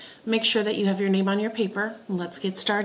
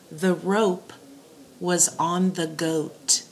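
slow dictation; slower than usual. Shown as a demonstration. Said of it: "give you your three dictation sentences".

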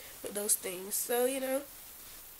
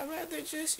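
normal talking voice

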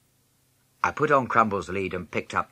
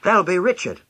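high, loud eager call; excited cry; 10 semitones higher, 5 dB louder than usual. A narrator's reading. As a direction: rush to the door calling out eagerly. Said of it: "Beryl cried".

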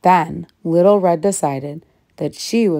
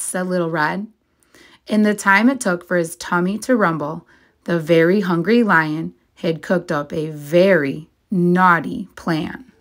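plain reading voice

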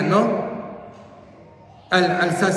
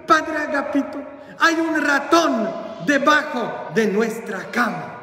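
high, hammed-up panic; anguished housekeeper; 8 semitones higher than usual. Housekeeper reports a mouse before the bishop, acted anguish. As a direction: cry out high and urgent, repeating the name. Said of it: "toda angustiada".

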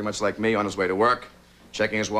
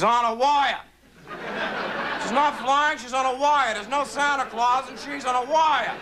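heckling jerk from the back row; 12 semitones higher than usual. Retold or imitated. A heckler in the back row jeering at the children. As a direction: sneer it like a know-it-all heckler. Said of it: "in a deep voice".

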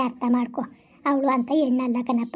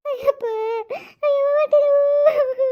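crying out in pain; 12 semitones higher than usual. Acted. Woman in labor, shrieking so her son begs her to stop. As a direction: scream the pain out. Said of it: "ಬೊಯ್ಬೇಡ, ಸುಮ್ನಿರವ".

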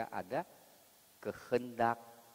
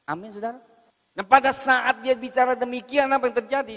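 preaching at full force; 17 dB louder than usual. Acted. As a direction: hammer the point home from the pulpit.

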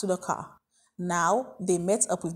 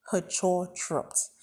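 usual speaking voice

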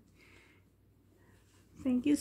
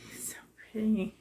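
whispered, almost to herself; awed whisper; 2 semitones lower, 7 dB quieter, much faster than usual. Real, not acted. Unboxing a reborn doll, whispering in awe. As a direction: breathe it out in a hushed whisper. Gushing over doll, hushed.

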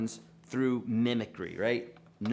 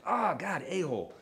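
imitating a pained yelp, Oh God raised; pained outburst; 6 semitones higher than usual. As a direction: yelp as if scratched again. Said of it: "I said".

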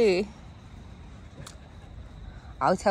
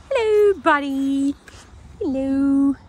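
high, loud sing-song greeting to a dog; higher, louder pet voice for the buddy; pet talk; 6 semitones higher, 6 dB louder, slower than usual. Real, not acted. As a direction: coo hello up high at the dog.

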